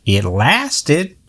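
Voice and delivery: put-on proudly in a Southern accent. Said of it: "in her own southern accent".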